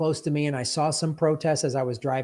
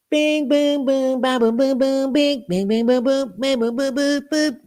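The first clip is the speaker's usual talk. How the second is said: high, loud scat of guitar riffs; scatted surf guitar; 12 semitones higher, 5 dB louder than usual. Pretend. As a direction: sing the surf riff with bings and booms. Scatting surf rock guitar sounds.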